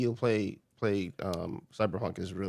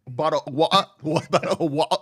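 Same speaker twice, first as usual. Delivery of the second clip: laughing mock British; 6 semitones higher, 5 dB louder than usual. Laughing imitation of British phrase.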